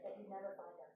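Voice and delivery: usual speaking voice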